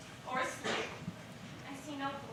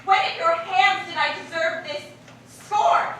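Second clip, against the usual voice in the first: loud, rising hurt anger; indignant outburst; 2 semitones higher, 8 dB louder than usual. Acted. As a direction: let the hurt burst out. Helena feels mocked.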